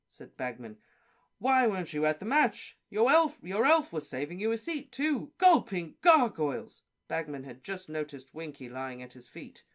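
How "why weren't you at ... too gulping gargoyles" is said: excited. Acted. Bagman rattling on loudly.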